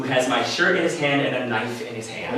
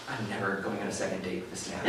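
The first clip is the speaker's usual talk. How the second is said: low, soft aside to yourself; deadpan thinking aloud, much softer; muted inner thought; 8 semitones lower, 14 dB quieter than usual. Retold or imitated. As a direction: drop pitch and volume for a private thought. Private thought voiced as punchline.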